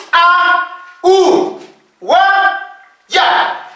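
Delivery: each Quechua letter sounded singly with pauses; alphabet reading demo. Shown as a demonstration. Teacher shows students how to read the Quechua alphabet, letter by letter. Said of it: "La siguiente forma".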